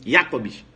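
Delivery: "Jacobs" low, YA-cobs in one piece; joined-up pronunciation. Shown as a demonstration. Teacher joins the syllables, German pronunciation. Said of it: "Agora junta".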